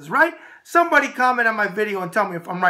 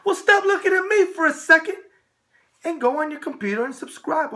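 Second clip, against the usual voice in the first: playful urging; 7 semitones higher than usual. Acted. Urging viewers to subscribe.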